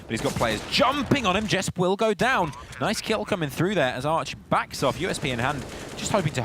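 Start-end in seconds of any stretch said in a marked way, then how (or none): 0.7-4.8 esports caster. Real, not acted. Hype call as players jump Arch.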